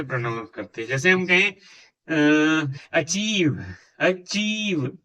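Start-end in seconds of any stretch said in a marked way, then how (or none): 2.9-5.0 "अचीव" with the ee held long; long vowel. Shown as a demonstration. Teacher demonstrating long sounds, "अचीव" stretched on the ee. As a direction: draw out the ee in "अचीव". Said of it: "long sound आ रही है".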